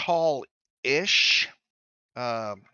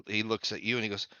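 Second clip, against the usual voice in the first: ordinary talk, nothing marked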